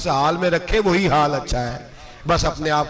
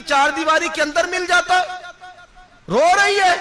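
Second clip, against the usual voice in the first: righteous anger; 12 semitones higher than usual. Retold or imitated. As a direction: scold her with blazing wrath. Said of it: "हजरत उमर जलाल में आ गए".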